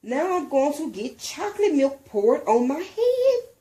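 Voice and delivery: put-on high, loud childish dread; scared kid fearing the bus; worried child. A narrator's reading. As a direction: wail about the chocolate milk.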